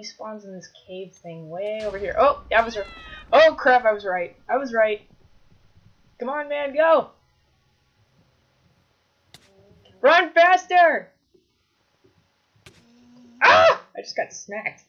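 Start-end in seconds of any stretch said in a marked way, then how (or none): 2.2-5.0 loud startled burst; alarmed realisation. Real, not acted. Player finding his guess was right, alarmed. Spots the monster where predicted. 6.2-11.1 high, loud, building to a stretched yell; urgent shouting. Real, not acted. Fleeing player urging a teammate to run, panicked shout. 13.4-13.8 very high, loud yelp; startled scream. Real, not acted. Player getting smacked, startled scream. Gets hit by monster.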